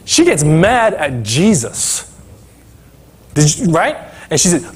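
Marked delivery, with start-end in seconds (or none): none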